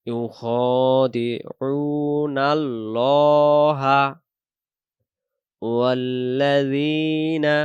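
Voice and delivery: loud, very slow chanted recitation; full Quran recitation. Shown as a demonstration. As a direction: recite the whole verse slowly, with tajweed. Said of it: "এখান থেকে".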